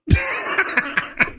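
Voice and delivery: high cackle; real high-pitched chuckling; laughing. Real, not acted. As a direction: laugh off the dare. Laughs after his dare.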